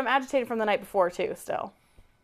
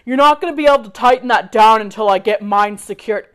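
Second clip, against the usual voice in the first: mock husband; 9 dB louder than usual. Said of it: "And he's like".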